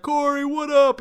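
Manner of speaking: high, imitated shout of greeting; hailing a buddy. Another guy at the bar greeting Corey, excitedly hailing. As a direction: whoop a big hello.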